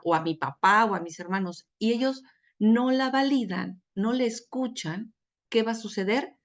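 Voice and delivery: ordinary talk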